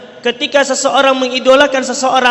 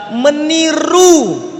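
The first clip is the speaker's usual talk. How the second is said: "meniru" held very long, higher, then dropping; penekanan pada "meniru"; 4 semitones higher, much slower than usual. Real, not acted. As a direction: stretch "meniru" high and slow for emphasis. Key point repeated, drawn out. ("meniru" dipanjangkan dengan nada tinggi)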